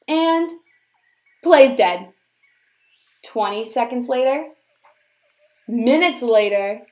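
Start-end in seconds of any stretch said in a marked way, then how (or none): none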